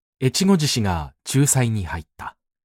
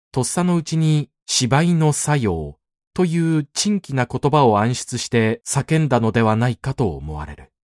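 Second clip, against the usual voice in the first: ordinary delivery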